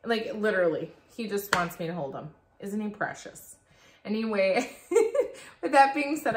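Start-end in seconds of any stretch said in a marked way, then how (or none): 4.0-6.4 high giggle spilling into the words; laughing. Real, not acted. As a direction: laugh through the sign-off. Laughs starting her sign-off.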